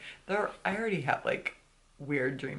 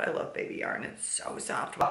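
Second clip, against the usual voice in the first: whispering; 5 dB quieter than usual. Real, not acted. Fond whisper about soft yarn.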